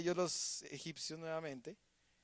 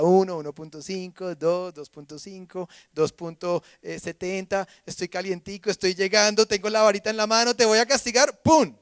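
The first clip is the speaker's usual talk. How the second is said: de conteo lento a amenaza gritada; building threat; 11 dB louder than usual. Retold or imitated. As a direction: empieza contando despacio y termina en un grito con ¡pum!. Mother counting up to punishment. (madre que cuenta lento y acaba gritando la amenaza)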